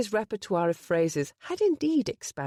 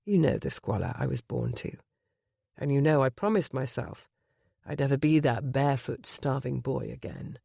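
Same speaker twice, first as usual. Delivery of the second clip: low husky voice; 5 semitones lower than usual. Acted. Gabriel to Penny before the wedding, low husky confession. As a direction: lay bare his past in a low voice. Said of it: "his low, husky voice".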